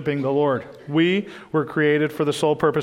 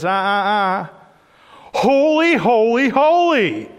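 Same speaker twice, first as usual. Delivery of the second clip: much higher and louder, topping the first cry; outdoing shout; 6 semitones higher, 5 dB louder, slower than usual. Acted. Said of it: "another one looks over ... and says".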